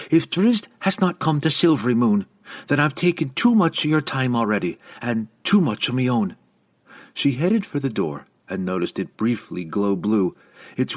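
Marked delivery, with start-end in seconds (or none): 0.1-6.3 raised, brusque dismissal; impatient. A narrator's reading. Young woman ending her audience with the lady, impatient. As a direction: press on firmly, eager to leave.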